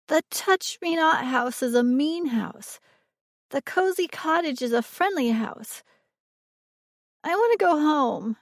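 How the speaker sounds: put-on high, plaintive child's voice; a small girl insisting she wants out, pitched high; little girl, near tears. A narrator's reading. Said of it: "Her bottom lip quivered".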